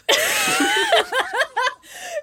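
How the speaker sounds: squealing laugh; high burst of laughter. Real, not acted. Cracking up after reading the angry quote, high laugh. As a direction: break into a high helpless laugh.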